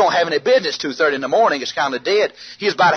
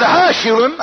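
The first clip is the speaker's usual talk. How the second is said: higher, louder, insistent scolding; exasperated hushing; 9 semitones higher, 4 dB louder, slower than usual. Retold or imitated. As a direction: shush him urgently over his whooping. Exasperated second hushing over whoops.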